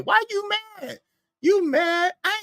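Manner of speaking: mocking a mad viewer in a high voice; high mocking tease. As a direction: needle him with a squeaky taunt. Teasing angry chat user.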